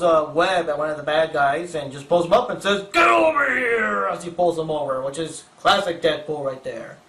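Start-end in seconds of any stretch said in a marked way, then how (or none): 2.9-4.1 slow, shouted game battle cry; Mortal Kombat shout. Acted. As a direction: bellow it like a fighting game announcer. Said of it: "more Mortal Kombat references".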